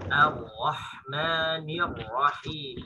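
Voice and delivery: slow, drawn-out Ar-Rahmanir Rahim with a thin A; Quran recitation. Shown as a demonstration. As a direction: recite Ar-Rahmanir Rahim slowly, tajwid style. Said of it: "Ingat, hamzanya tipis".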